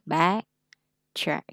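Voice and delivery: teacher's model of stressed then unstressed vowel; stressed vowel wider. Shown as a demonstration. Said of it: "cái miệng mình nó sẽ mở rộng hơn".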